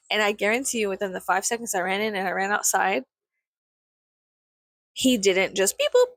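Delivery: usual speaking voice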